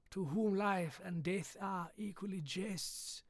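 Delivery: lightly voiced Izzard-style James Mason, measured and slow, ending in a whisper; a mild stab at Eddie Izzard's James Mason impression, slowing down and fading to a hush; understated Izzard's James Mason voice with the last words whispered; Eddie Izzard doing James Mason. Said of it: "now we're doing Eddie Izzard doing James Mason".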